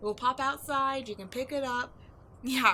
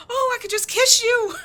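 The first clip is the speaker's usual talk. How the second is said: much louder and higher, gushing with delight; giddy; 12 semitones higher, 14 dB louder than usual. Acted. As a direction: blurt it out in giddy joy.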